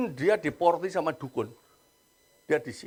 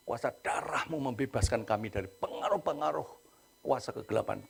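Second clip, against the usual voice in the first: softer, steady earnest prayer voice; deliverance prayer; 3 semitones lower, 5 dB quieter than usual. Acted. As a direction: pray it like a pastor leading by phone. Leads deliverance prayer over phone.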